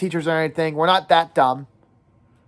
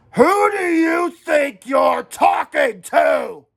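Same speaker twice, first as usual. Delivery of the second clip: higher, louder and very slow furious shout; booming shout; 6 semitones higher, 4 dB louder, much slower than usual. A narrator's reading. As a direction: let each word fill the gym. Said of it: "The words filled the gym".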